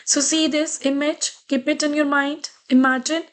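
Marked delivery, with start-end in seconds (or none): none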